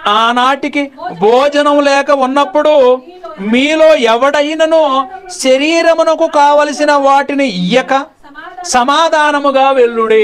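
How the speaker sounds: slow, high, loud scripture recitation; solemn scripture reading. Acted. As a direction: intone the verses slowly like a preacher. Reads scripture aloud in sermon. (నెమ్మదిగా, గంభీరంగా లేఖన పఠనం)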